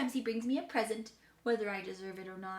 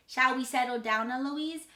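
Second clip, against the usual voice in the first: louder, higher parroting; child mimicking tutor; 4 semitones higher, 6 dB louder than usual. A narrator's reading. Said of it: "And I say".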